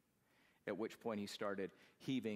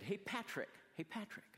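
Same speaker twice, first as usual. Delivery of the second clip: high calling out of a car window to a friend; 9 semitones higher than usual. Retold or imitated. Calling out to Patrick walking along the road, high-pitched. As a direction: call his name up high.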